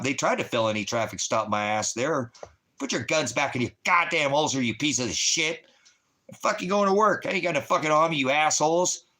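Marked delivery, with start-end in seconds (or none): 2.8-9.1 angry shouting, pitch up, louder; barking at the cops. Retold or imitated. Yelling at the cops, barking angrily. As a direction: bark it at the armed cops. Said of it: "barking at 'em like I do".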